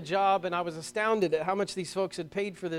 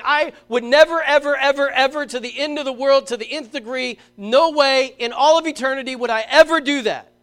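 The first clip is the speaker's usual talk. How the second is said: loud, high emphatic declaration; Peter's loud insistent never; emphatic vow; 6 semitones higher, 10 dB louder than usual. Retold or imitated. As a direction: swear it to the end of the world. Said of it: "this is an emphatic statement".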